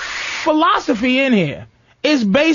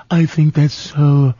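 imitating Jeff; 7 semitones lower, slower than usual. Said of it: "Jeff wouldn't do it".

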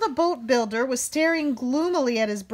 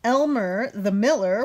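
plain reading voice

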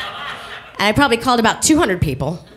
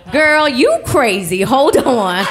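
high laughing friend on the line; laughing operator; 8 semitones higher than usual. Retold or imitated. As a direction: cackle it out at her. Operator LaTanya laughing at her.

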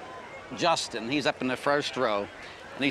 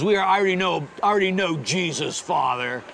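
lightly voiced cocky student, pitched up; 4 semitones higher than usual. Student brushes off the priest's retreat invitation, lightly mimicked cocky reply.

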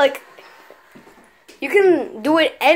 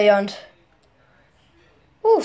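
usual speaking voice

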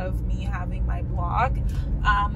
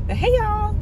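high, loud bubbly hello; bright high greeting to the viewers; excited greeting; 12 semitones higher, 7 dB louder than usual. Real, not acted. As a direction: burst back on camera with energy. Restarting the vlog with a greeting.

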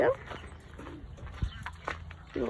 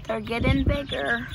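ordinary delivery

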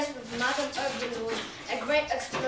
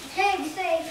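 normal talking voice

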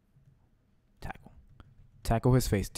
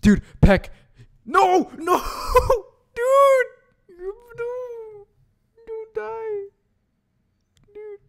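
high, loud panicked screams; frantic yelling; 3 dB louder, much slower than usual. Real, not acted. Gamer begging his pokemon not to die, shrieking. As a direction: shriek at the screen as it dies. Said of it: "dude, I'm crying".

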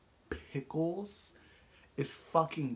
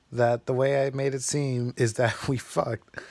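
ordinary talk

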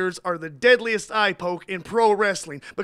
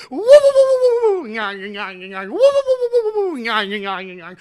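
Curly woo-woo nyah; 4 semitones higher, 8 dB louder than usual. Acted.

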